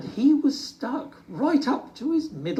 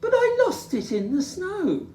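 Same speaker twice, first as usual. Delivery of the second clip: amazed; 3 dB louder than usual. Acted. Little Bear amazed.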